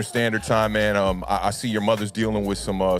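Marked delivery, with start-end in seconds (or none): none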